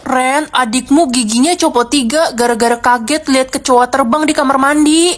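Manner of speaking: loud, alarmed shouting; mother yelling the news to Ren. Acted. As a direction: shout the bad news across the house. Mom reports son's teeth.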